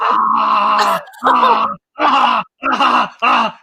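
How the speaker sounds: loud, high cheering cries; mock applause shouts. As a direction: shout like a roaring crowd. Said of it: "We go".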